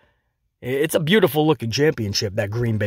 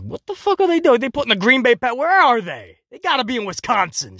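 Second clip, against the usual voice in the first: high, confused disbelief; baffled; 7 semitones higher than usual. Retold or imitated. Vlogger reacting to the Packers title, baffled and high. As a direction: pitch way up in mock bewilderment. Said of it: "I was like".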